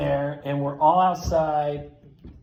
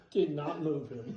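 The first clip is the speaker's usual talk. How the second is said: usual speaking voice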